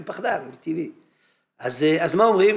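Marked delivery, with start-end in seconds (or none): none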